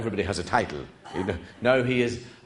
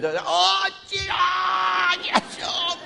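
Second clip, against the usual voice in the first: mock howl; 4 dB louder, slower than usual. Retells the pained howl.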